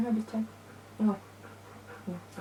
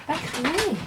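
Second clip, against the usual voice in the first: higher, sing-song "Pas crier" to the dog; high scolding; 8 semitones higher than usual. Real, not acted.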